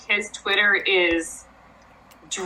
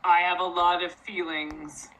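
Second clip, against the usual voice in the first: forcing a deep voice; 5 semitones lower than usual. Retold or imitated. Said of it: "his fake deep voice".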